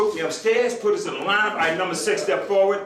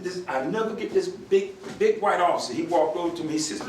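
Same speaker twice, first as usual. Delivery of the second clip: normal talking voice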